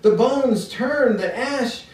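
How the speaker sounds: incantatory poem recital with long, rolling phrases; poetry reading. Acted. Poet reading an older extended poem to an audience, poetry reading. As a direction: recite it as a poem, building in waves and settling on the refrain.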